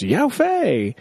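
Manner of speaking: high mock yell, no real volume; imitated shout. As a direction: call out the name as a pretend shout. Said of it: "He just shouts".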